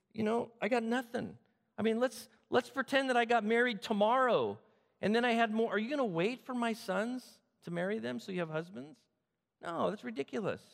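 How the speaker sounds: high-pitched, incredulous reasoning; exasperated arguing. Acted. Widow arguing her daughters-in-law out of staying, exasperated. Naomi argues they should go home.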